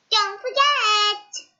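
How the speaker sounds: high, loud, slow goblin warning. Acted. Goblin warning Mister Appleseed, high and slow. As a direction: warn him slowly from up high.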